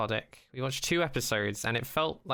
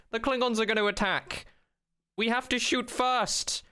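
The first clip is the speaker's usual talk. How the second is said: urgent warning; 9 semitones higher than usual. Retold or imitated. Quoting the character's warning.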